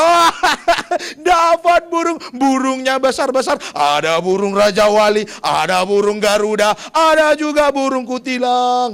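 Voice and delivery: loud laughing cry, stretched slowly; madman laughing. Acted. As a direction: giggle it out like a delighted madman. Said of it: "dia bilang".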